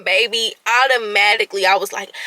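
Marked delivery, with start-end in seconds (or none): none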